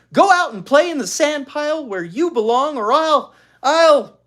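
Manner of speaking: high, loud, flustered command; frantic bossy order. A narrator's reading. Beezus orders Ramona outside, frantic and loud. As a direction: sputter for a threat that won't come.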